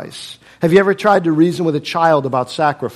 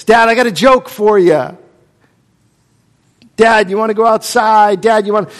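put-on eager kid; 6 semitones higher, 8 dB louder than usual. Kids pestering dad.

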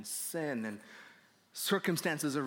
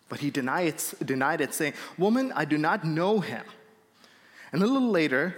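loud, emphatic Bible reading; scripture reading; 6 dB louder than usual. Acted. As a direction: project the passage to the congregation. Reads the Bible passage aloud.

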